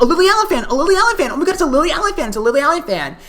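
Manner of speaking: high-pitched, insistent repeated squeal; excited pointing out. Retold or imitated. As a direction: imitate someone urgently tapping a friend's arm. Cousin urgently pointing out the fan.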